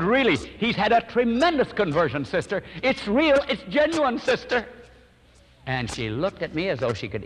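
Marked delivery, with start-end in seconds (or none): 0.0-4.7 much higher, loud insistent plea; preacher pleads with a doubting wife, high and earnest; earnest pleading. Retold or imitated. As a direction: beg her to believe it's real.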